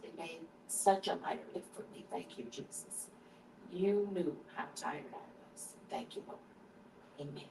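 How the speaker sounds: soft, hushed grace before eating; whispered table prayer; quiet prayer. Real, not acted. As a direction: pray quietly over the meal. Prays over the food.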